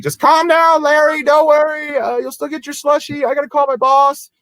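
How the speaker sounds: hammed-up comfort for a worried regular; mock calming reassurance. Handcuffed clerk calming regular Larry about his slushie, high mock reassurance. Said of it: "I'm like".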